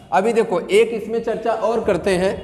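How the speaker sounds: ordinary talk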